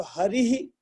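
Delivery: हरि ही with the i echoed after an h; visarga vowel echo. Shown as a demonstration. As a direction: end हरि ही in "-hi", not "-ha". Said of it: "जो भी स्वर है उसको बोलिए". (हरि ही में इ की गूंज)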